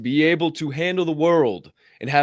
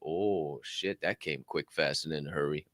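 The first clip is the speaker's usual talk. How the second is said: weary dismay; 8 semitones lower, 10 dB quieter than usual. Retold or imitated. Retells reaction to the alarm.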